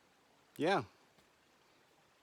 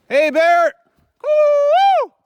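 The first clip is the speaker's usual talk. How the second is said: shouted bear call, very high and loud; scaring off a bear; 21 dB louder, much slower than usual. Real, not acted. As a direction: yell it out to warn a bear away. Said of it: "I'm bear calling".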